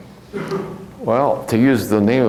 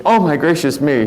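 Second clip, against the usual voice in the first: higher-pitched, mild grandmotherly exclamation; exclaiming grandmother; 8 semitones higher than usual. Retold or imitated. Grandmother cuts her thumb while baking, mock genteel exclamation. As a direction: imitate grandma's startled little oath. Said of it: "My grandmother cut her thumb".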